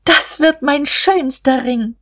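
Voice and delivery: high-pitched, drawn-out delight; jubilant. A narrator's reading. Guste is given the diamond, jubilant. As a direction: exult over it, high and slow. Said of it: "Da jubelte Guste".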